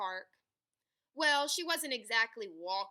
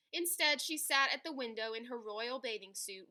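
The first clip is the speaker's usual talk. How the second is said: plain reading voice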